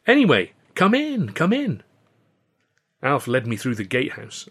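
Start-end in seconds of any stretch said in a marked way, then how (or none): 0.0-1.8 high, loud, sing-out welcome; bright-pitched, emphatic calling in; hearty invitation. A narrator's reading. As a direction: usher a friend in with gusto. Host waves old friend inside.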